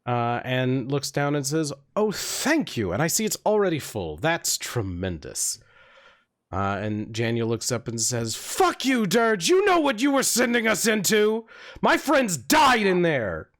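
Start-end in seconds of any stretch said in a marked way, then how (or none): 2.0-5.6 pleased bard. Acted. Bard thanking the orc for the vial, lilting and pleased. 8.4-13.5 high, furious orc outburst; angry shout. Acted. Orc blaming the bard for dead friends, shouting angrily.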